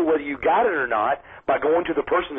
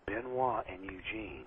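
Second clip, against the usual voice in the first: disbelief; 7 semitones lower, 16 dB quieter than usual. Retold or imitated. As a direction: mutter it in stunned disbelief. Disbelief at a booked match.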